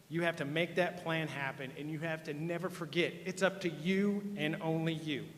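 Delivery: ordinary talk, nothing marked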